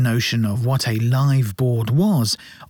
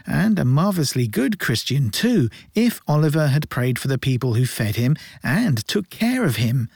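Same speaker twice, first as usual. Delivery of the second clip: ordinary delivery, nothing marked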